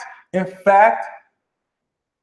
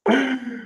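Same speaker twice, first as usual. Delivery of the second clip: burst of laughter; 7 semitones higher, 4 dB quieter than usual. Real, not acted. Laughing at [name]' birthday.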